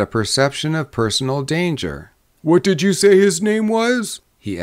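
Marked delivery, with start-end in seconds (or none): 2.4-4.2 high-pitched, anxious query; questioning drummer. A narrator's reading. Drummer torn between interest and danger, asking anxiously. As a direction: ask with the voice pitched well up. Nervous drummer asks the name.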